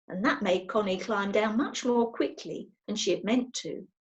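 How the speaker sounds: usual speaking voice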